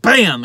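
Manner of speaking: loud, high "bam"; imitated hit. Dano hits the guy with a pole, punched sound effect.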